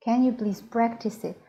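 normal talking voice

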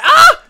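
high, loud startled scream; sharp scream. Real, not acted. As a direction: yelp as you get hit. Scream as player dies.

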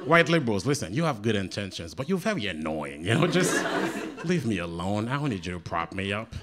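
usual speaking voice

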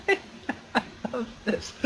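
slow breathless whisper; awed whisper. Real, not acted. Gamer loving the wild ride: slow awed whisper. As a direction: breathe it out in delight. Awed delight mid-race.

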